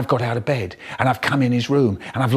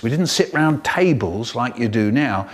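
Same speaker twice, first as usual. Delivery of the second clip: ordinary delivery, nothing marked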